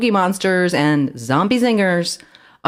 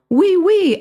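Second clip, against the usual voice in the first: high, louder excited "Oui, oui"; excited exclamation; 4 dB louder than usual. A narrator's reading. Mouse thrilled Timothy finally understands, excited. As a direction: burst out thrilled like a tiny French mouse. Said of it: "Ellie exclaimed, excited and pleased".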